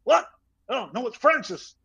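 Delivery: high-pitched put-on American phone voice; imitated older man struggling to be heard; Francis Ford Coppola on the phone. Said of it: "he got on the phone again".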